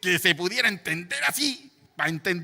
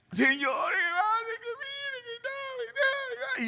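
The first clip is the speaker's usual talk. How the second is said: very high, slow, sobbing mock plea; mock tearful prayer; 5 dB quieter, slower than usual. Preacher mimicking how he used to pray, high crying wail. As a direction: wail it like an exaggerated desperate prayer. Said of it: "Me estoy imitando a mí cuando yo oraba".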